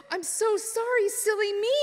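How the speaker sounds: high innocent put-on sorry; exclaiming. A narrator's reading. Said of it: "exclaimed Daughter Goose".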